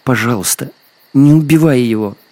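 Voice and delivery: high voice rising to a loud entreaty; pleading, climbing girl's cry; tearful plea. A narrator's reading. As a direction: beg desperately for a life. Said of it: "Белка всхлипнула".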